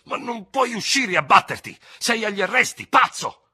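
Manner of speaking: high, loud, scornful protest; angry contempt. A narrator's reading. Dubert reminds the madman he is under arrest, angrily scornful. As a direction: object furiously, building to the insult. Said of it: "obiettò con disprezzo iroso".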